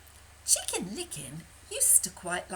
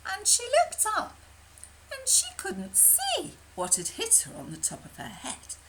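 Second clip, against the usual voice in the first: usual speaking voice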